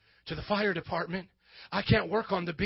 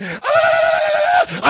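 high war cry with a severed head lifted; mock battle roar; 10 dB louder than usual. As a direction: let out a triumphant warrior yell. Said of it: "and went".

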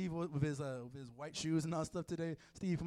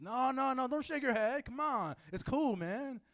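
higher, louder stoner pleading his case; insistent stoner; 4 semitones higher, 5 dB louder than usual. Acted. Preacher playing a blunt smoker defending himself, worked-up stoner. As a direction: argue earnestly like a hammed-up pothead. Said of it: "you're gonna smoke a blunt or something".

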